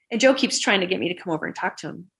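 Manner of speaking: ordinary delivery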